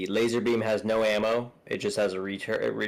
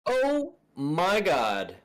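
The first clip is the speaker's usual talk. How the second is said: exasperated; 8 semitones higher, much slower than usual. Real, not acted. Slow exasperated exclamation.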